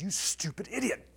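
angry self-insult. Retold or imitated. The preacher at the chess machine, angry insult. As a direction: snap at yourself. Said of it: "And I said".

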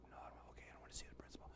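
a tiny breathless whisper; whispered reply. Retold or imitated. Boy whispers back to his teacher, chastened. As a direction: answer barely aloud, chastened. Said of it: "And I whispered in his ear".

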